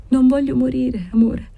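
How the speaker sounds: high, dazed pleading; a dying girl pleading to her love; delirious plea. A narrator's reading. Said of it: "parlava la demente".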